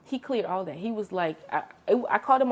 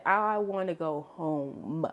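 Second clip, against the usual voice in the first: drawn-out, savoring each word; slow joyful relief; 2 semitones lower, much slower than usual. Retold or imitated. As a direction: stretch it out like pure relief. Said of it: "if I'd never felt any joy before in my life".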